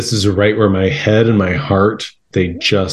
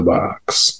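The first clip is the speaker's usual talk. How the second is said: whispered tease; 4 semitones lower, 4 dB quieter than usual. Pretend. Teasing prize reveal.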